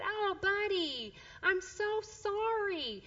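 high, slow, drawn-out motherly sympathy; sympathetic mom. Retold or imitated. Mom runs over to her fallen son, tender sympathy. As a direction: coo over a hurt little one. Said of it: "so as a mom, I ran over".

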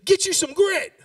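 full-voiced preacher's call to fire up the crowd; shout. Shown as a demonstration. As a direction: yell it at the person beside you. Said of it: "Turn and look at the person next to you and say".

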